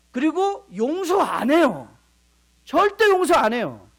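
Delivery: loud, slow, punching each word; emphatic preaching. Real, not acted. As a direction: hammer home that they never forgive. (크게 또박또박 강조하며)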